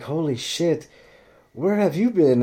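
calling out to a long-lost friend; excited surprise. Retold or imitated. Bandmates greeting their old friend, a surprised glad shout. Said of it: "And they're like".

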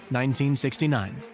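usual speaking voice

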